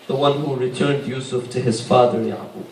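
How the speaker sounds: low, soft, quick undertone; hushed prayer. Acted. Mother invokes the one who returned Yusuf, hushed prayer.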